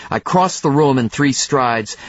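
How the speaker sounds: ordinary delivery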